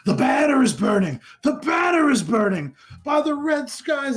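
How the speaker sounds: high, loud frantic cry; wild drunken shout. Acted. Drunk old man grabbing you by the shoulders, wild shouting. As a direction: cry out the warning at full pitch. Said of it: "grabs you by the shoulders and shouts".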